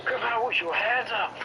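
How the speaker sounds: high cop call; police shout. Pretend. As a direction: order them out as police. Playing police.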